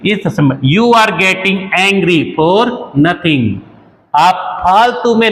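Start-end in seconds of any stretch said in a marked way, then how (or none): 0.7-3.4 slow, deliberate teacher read; slow example sentence for learners; slow model sentence. Shown as a demonstration. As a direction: dictate each word slowly. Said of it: "आगे देखिए".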